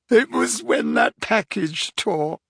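put-on high tearful girl's voice, slow; sobbing. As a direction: explain through sobs. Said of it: "sobbed Leanne".

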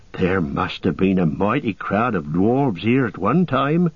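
simple companion with a lilting, swinging tune; Sam, wondering. Acted. Hobbit amazed at the dwarves' diggings, lilting wonder. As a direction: marvel aloud in homely up-and-down tones.

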